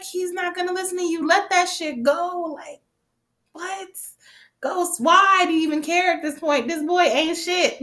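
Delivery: high-pitched, fed-up talking back; exasperated pleading. Retold or imitated. Viewer telling Ghost to let Tariq go, exasperated. Reacting in exasperation to the show's characters.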